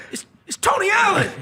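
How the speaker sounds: loud, high starstruck cry; excited shout. Retold or imitated. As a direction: blurt out the name like a starstruck fan. Fan tells girlfriend, shouting.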